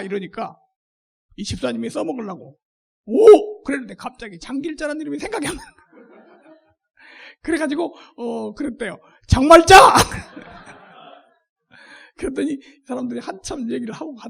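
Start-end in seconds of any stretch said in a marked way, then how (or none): 3.1-3.6 a sudden loud, high "오!"; bursting out in eager recognition; loud excited oh. Retold or imitated. Deacon eagerly answers recruiters. 9.3-9.7 proudly yelling out the name; loud triumphant blurt. Retold or imitated. Deacon blurts wrong name proudly. 9.8-10.7 loud, high burst of laughter; cracking up at the joke. Real, not acted. Laughs at own story.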